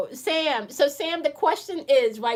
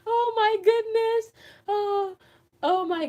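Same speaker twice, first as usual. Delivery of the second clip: laughing disbelief; 12 semitones higher, 6 dB louder, slower than usual. Real, not acted. Reacting to chat, laughing.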